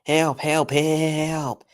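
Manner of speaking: high, sustained mock cry for help; Michael Brandon. Imitating a narrator's help scream, high mock cry. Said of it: "First up, we got Michael Brandon".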